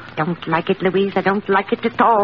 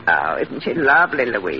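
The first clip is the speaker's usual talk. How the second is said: ordinary delivery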